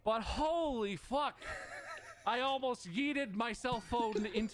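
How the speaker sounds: high, slow disbelieving burst; exasperated. Real, not acted. Venting to a friend about the call, exasperated. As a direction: let the frustration spill out.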